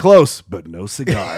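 slow deep title announcement; announcer voice. Acted. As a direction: announce the title slowly and low.

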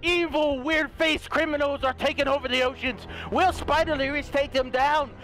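hammed-up breaking-news teaser voice; mock news announcer. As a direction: announce it like a dramatic TV news promo.

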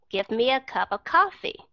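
connected American phrasing, "cup of" linked; quick native linking. Shown as a demonstration. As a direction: run the words together as one phrase. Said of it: "họ nói rất là mau".

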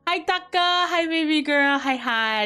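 high squealed hello, gliding down; gushing greeting. Real, not acted. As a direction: squeal in delight, then coo downward. Gushing greeting to arriving Taka.

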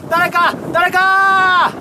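slow, stretched cry for help; drawn-out call for help. Acted. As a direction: call out slowly for someone.

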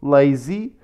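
normal talking voice

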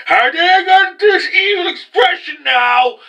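high, drawn-out mock villain shout; hammed-up evil outburst, stretched slow; evil villain impression. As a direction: screech it like an over-the-top anime villain. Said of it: "Does, does that evil expression shit".

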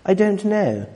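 quoting the daughter in a light posh voice; posh little girl. Retold or imitated. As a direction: mimic her posh way of talking. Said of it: "That's how she talks, very posh".

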